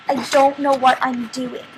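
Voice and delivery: loud, slow outburst on the same pitch; acted shout. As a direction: belt it out in frustration, word by word. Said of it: "shouted at the top of my lungs".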